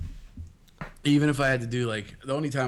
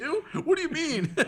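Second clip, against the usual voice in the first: high, incredulous question through laughter; mock disbelief; 14 semitones higher than usual. Mocking the answer forty-two, laughing incredulity. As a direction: squeak it out in fake shock.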